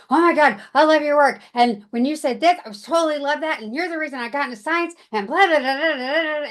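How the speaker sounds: breakneck fan babble, imitated; run-on gushing. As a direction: gush without stopping for a full stop. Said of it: "one run-on sentence of".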